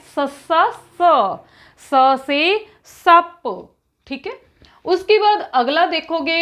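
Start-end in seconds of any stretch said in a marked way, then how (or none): 0.1-3.7 each syllable drawn out very slowly; slow recitation. Shown as a demonstration. Teacher drilling pupils on the letter, very slow syllables. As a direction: spell it out slowly for the children to memorise. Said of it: "जब याद करते हैं".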